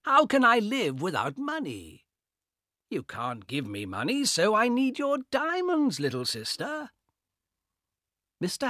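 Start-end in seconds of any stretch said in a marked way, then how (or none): 0.0-6.9 taunting brother. Acted. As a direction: sneer at his little sister. Carl taunts his sister.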